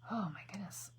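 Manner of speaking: hushed whisper to herself; soft focused whisper. Real, not acted. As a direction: whisper it while you concentrate. Concentrating on drawing face.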